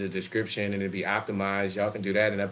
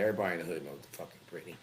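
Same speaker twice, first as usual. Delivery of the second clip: ordinary talk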